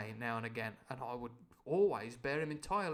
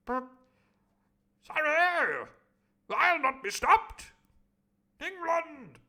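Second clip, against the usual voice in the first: high loud slow patriotic bluster; defiant Squire; 13 semitones higher, 9 dB louder, slower than usual. Acted. The Squire refusing to stop, high and blustering. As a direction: puff up with national pride.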